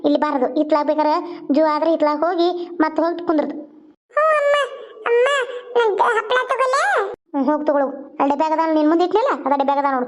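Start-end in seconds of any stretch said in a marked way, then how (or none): 4.2-6.8 put-on high, small child voice; little child. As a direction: ask mother's permission in a little child's high voice. Little daughter asks mother for snack. (ಅಮ್ಮನನ್ನು ಕೇಳುವ ಪುಟ್ಟ ಮಗುವಿನ ಎತ್ತರದ ದನಿ)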